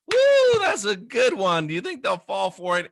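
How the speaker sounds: high, loud whoop of delight; delighted scheming whoop; gleeful gloating. Retold or imitated. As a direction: gloat like a con man who just thought it up. Schemers gloat over the trick.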